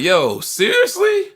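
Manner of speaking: slow, high-pitched disbelief; incredulous, retold. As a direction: let the question soar in disbelief. Retold reaction to thrown ticket.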